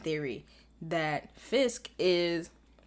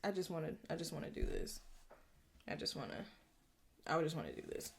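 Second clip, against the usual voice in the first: much softer, almost breathless wish; hushed plea; 3 semitones lower, 14 dB quieter, faster than usual. Retold or imitated. Clint voiced asking Kate for one shot, hushed. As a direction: murmur it like a secret wish. Clint's quiet request, acted.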